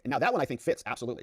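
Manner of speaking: normal talking voice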